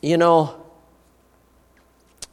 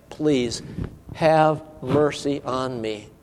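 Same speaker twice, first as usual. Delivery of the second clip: humble plea; 5 semitones lower, 9 dB quieter, slower than usual. Acted. Beggar's humble plea retold.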